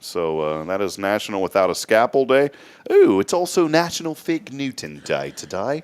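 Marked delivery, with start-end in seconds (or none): 2.9-5.8 put-on British accent, higher pitched. Host offering his co-host a treat, bad British accent. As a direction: do a playful, terrible British accent. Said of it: "With your British accent".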